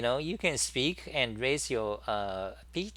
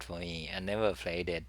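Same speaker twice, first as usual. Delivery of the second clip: breathy whispered aside; 5 semitones lower, 5 dB quieter than usual. Real, not acted. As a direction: drop to a whisper. Quiet aside after laughing.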